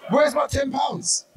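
high, slow, half-laughing demand; imagined Facebook callout to an old friend, mock outrage; mock angry shout. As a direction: yell it at her like she still owes you. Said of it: "just so I could say".

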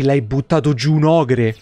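ordinary talk

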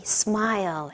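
soft whispered encouragement. Retold or imitated. Pilgrims in silence, cheering each other on with a whisper. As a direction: breathe the word out without voice.

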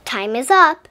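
loud teacher call, time's up; announcing loudly. Acted. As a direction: call it out to the whole class. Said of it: "Miss Topel announced".

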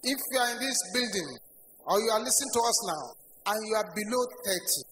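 usual speaking voice

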